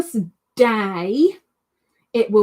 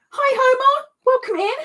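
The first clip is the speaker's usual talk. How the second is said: squeaky greeting; 4 dB louder than usual. Real, not acted.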